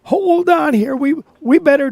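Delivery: jolted, half-joking alarm; startled hold on. Real, not acted. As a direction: pull up short at the price. Startled by the high price.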